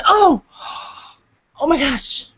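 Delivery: startled gasp. Real, not acted. Sudden startle on camera.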